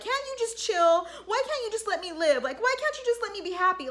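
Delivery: high-pitched, exasperated pleading; irritated. Retold or imitated. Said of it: "she gets all irritated".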